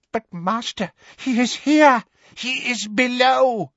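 high, frantic, pleading voice; desperate warning. A narrator's reading. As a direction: urge the master in panic. Bellatrix desperately warns her master.

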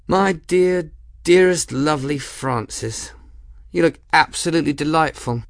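high, sweetly mocking lady's voice; teasing woman captain. A narrator's reading. Woman captain teasing the scowling Grim, high and sugary. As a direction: coo it at him with false affection.

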